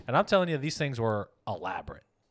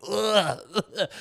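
high, mocking ugh sounds through a laugh; imitated disgusted groans; 8 semitones higher, 4 dB louder than usual. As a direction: groan in disgust like the whole bus. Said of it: "hearing everybody just like".

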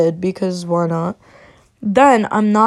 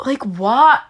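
exasperated; 5 semitones higher, 4 dB louder than usual. Real, not acted. Exasperated reaction to his question.

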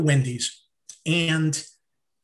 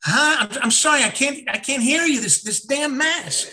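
loud, high, flustered shout; can't hear a thing, call louder; 7 semitones higher, 4 dB louder than usual. Retold or imitated. Man in mask shouting over plexiglass, flustered and loud. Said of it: "Here's me".

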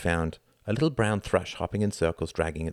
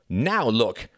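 put-on higher, louder boy's complaint; cross cry; 5 semitones higher, 5 dB louder than usual. A narrator's reading. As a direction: cry out in annoyance. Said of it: "cried Reginald".

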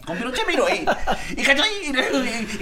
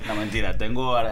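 ordinary talk

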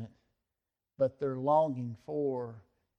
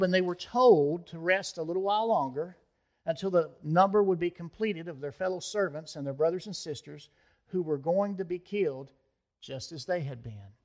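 ordinary delivery

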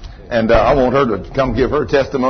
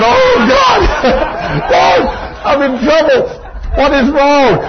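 high, comic alarmed thought; higher half-laughing mock dread; mock panic; 12 semitones higher, 3 dB louder than usual. Preacher recalls his panicked thought.

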